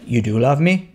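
low, sobered question; really serious drunk. Acted. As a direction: ask it in mock gravity, low. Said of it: "like, really serious".

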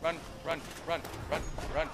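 being chased. Real, not acted. Fleeing the deathclaw.

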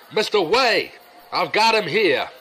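high, carrying call across the yard. Acted. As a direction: shout over to summon the boss.

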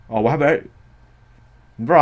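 usual speaking voice

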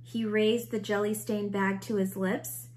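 plain reading voice

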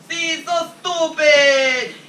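put-on slow, drawn-out Italian accent. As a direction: stretch it out like a stage Italian. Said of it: "Stop talking like an Italian".

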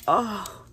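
loud gasp, almost a cry of joy; delighted gasp. Real, not acted. Seeing the swans, gasping with joy.

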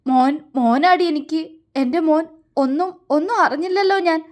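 halting, repeated words rising in pitch; tearful lament. A narrator's reading. Father learning he has a son, tearful lament. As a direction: lament as if crying. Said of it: "പദം പറഞ്ഞു കരഞ്ഞു".

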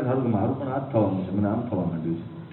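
a frightened man's hushed confession; fearful murmur. Retold or imitated. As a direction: voice sinking as dread sets in. Frightened man describes his dread.

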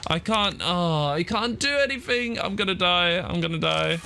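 high, drawn-out panicked whine; despairing wail. Real, not acted. Hurt gamer saying they're gonna die, high despairing wail. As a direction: wail it high, sure of losing.

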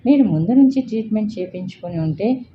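usual speaking voice

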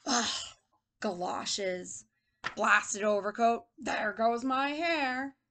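slow, grumbling complaint; angry old man. Acted. Teacher reads old man dressing for rain, slow and grumpy. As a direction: grouse through each annoyance. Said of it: "This man is very angry".

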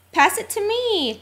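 high, playful calling out; eager call. A narrator's reading. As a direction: call for the ball in play. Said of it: "Cleo called".

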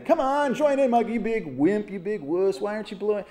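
taunting bully. Retold or imitated. Bullies taunting Mike in class.

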